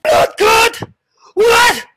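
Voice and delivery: high, loud, shocked yell; shocked outburst. Acted. Shocked friend yelling out in disbelief. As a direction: shriek it like you can't believe it.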